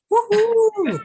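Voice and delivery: loud, high whoop; excited cheer. Real, not acted. Cheering the TikTok topic.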